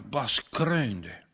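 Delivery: plain reading voice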